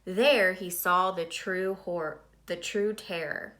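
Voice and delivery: ordinary delivery, nothing marked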